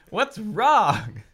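loud, high alarmed question. Acted. Daughter alarmed as her parents fall apart, loud. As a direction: demand it in alarm. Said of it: "I asked".

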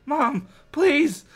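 high, loud plea; hammed-up kid cry; pleading cry. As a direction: beg it like a scared kid. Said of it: "he pleaded".

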